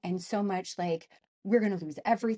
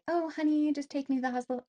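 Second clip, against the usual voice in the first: high, honeyed pretend plea; politely ask, pitched up, as the ideal wife would; mock-sweet request; 6 semitones higher, faster than usual. Wife imagining asking her husband nicely, mock-sweet. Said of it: "I would love to be like".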